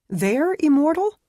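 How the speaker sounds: high, loud startled question; shocked disbelief. Acted. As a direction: blurt it out in alarm. Shocked at the vampire news.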